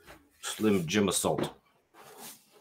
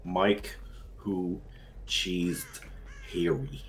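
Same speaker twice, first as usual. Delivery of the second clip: slow, separated words with pauses; word-by-word reading; 2 semitones lower, 5 dB quieter, much slower than usual. Pretend. Reading a gag phrase to friends, word-by-word with pauses. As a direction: sound it out one word at a time.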